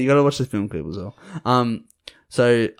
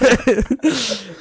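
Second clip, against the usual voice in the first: laughing; 10 semitones higher than usual. Real, not acted. Amused laugh at own rule-breaking.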